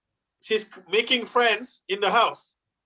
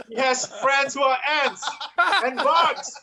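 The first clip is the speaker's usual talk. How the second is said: higher, laughing through it; 5 semitones higher than usual. Acted. Comedian to audience, laughing through the bit. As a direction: laugh at the sad joke. Mock pity for daughter.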